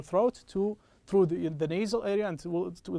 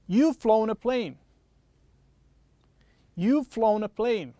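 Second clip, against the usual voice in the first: slow model sentence; 3 semitones higher, 6 dB louder, slower than usual. Shown as a demonstration. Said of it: "a little bit tricky".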